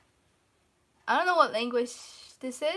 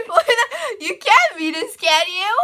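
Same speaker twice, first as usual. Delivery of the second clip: loud laughing tease; teasing through laughter; 8 semitones higher, 10 dB louder than usual. Real, not acted.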